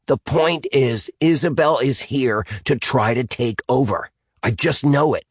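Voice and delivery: put-on high boy's voice, emphatic; insistent Dan. Dan convincing the group about Isabel, insistent.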